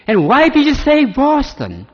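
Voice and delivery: high, loud and startled, "why" leaping up; a daughter stunned by a word in the sky; surprised daughter. Retold or imitated. Daughter in his dream, asking God why Boston, high and astonished.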